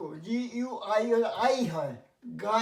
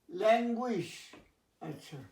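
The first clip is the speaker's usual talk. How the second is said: normal talking voice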